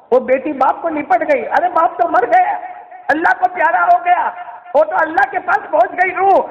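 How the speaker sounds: high, urgent sermon storytelling; ringing, forceful preacher; impassioned preaching. Acted. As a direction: narrate the grief at a fevered pitch. Preaches the grief scene.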